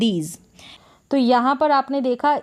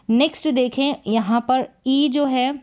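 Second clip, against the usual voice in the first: normal talking voice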